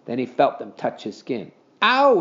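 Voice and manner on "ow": high, loud "ouch" cry; startled exclamation. A narrator's reading. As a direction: yelp at a sudden touch. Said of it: "he exclaimed".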